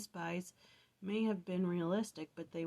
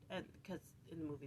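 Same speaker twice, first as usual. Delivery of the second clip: normal talking voice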